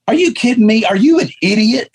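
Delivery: incredulous sister. Retold or imitated. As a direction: play the sister scolding him in a high voice. Sister's reaction to pregnancy test.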